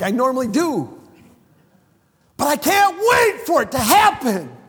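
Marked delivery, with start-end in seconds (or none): 2.4-4.6 rising excited cry of longing; excited shout. Real, not acted. Preacher to congregation, longing for resurrection, excited shout. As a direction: burst out with eager joy.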